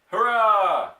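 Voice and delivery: loud, high cheer of delight. Acted. Injured father cheering the doctor's revenge, a loud high cheer. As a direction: whoop it out with glee.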